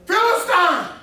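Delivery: high, loud exclamation; emphatic calling out. Real, not acted. Teacher quizzing the class on the reading, emphatic high shout. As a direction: fire it out to the room.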